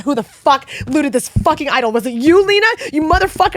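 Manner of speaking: mock furious yelling. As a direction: act out screaming at your friend over the loot. Retold yelling at friend Lena.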